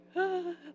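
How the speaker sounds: sharp high gasp of hurt; small pained intake of breath. Retold or imitated. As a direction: catch your breath as if stung. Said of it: "I'd feel like".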